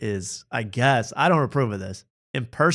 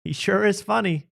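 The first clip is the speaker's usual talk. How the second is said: imitating family members; 9 semitones higher than usual. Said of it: "we're like".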